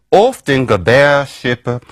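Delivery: put-on slow, careful Old English reading of "Oft in Gebärschippe". Shown as a demonstration. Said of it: "So I'm gonna try my version".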